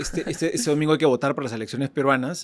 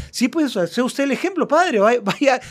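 higher, mock insistent crowd voice; pushy parishioner voice; 7 semitones higher, slower than usual. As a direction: needle the priest to go first. Parishioners pushing the priest.

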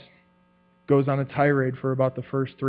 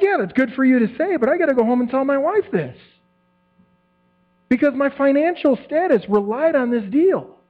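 exasperated retort; 9 semitones higher than usual. Retold or imitated. Retort to the boss.

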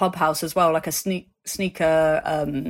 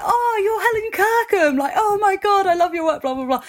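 much higher, louder gushing fan; excited recognition; 14 semitones higher, 3 dB louder than usual. Retold or imitated. Designer realising who she is, gushing with excitement. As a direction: burst with delight at the name.

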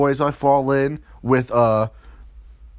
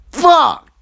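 high, loud shouted curse; dismayed outburst; 11 semitones higher, 8 dB louder than usual. Acted. Boyfriend learning she is pregnant, dismayed outburst. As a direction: burst out in mock horror.

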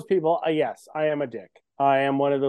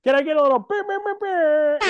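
mock censor beep; 9 semitones higher than usual. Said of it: "I'll edit it".

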